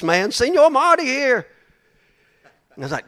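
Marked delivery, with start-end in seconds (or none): 0.3-1.5 excited fan. Retold or imitated. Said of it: "all these people all over the airport".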